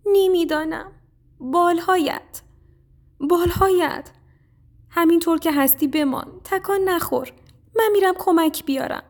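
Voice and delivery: high-pitched mouse voice, anxious; worried little mouse. A narrator's reading. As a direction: speak high and urgent to a hurt friend. Mouse worries over injured sparrow. (صدای زیر و نگرانِ موش کوچولو)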